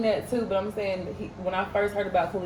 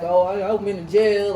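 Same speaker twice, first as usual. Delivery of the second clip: mock tough guy; 7 dB louder than usual. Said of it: "trying to act all hard body".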